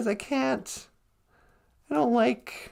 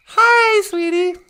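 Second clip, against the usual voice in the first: high, louder sweet greeting; 6 semitones higher, 7 dB louder, slower than usual. Pretend. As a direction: coo it to your friend. Acted sci-fi scene.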